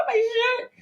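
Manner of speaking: high laughing shriek of panic; mock panicked scream. Panicking over her shirt in the wash, laughing scream. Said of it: "I'm like".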